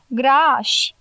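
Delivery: "Grași" drawn out long, falling; "Grași" said slowly and weightily, with relish; emphatic confirmation. A narrator's reading. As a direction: stretch the vowel of "Grași" for emphasis. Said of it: "întări țiganul".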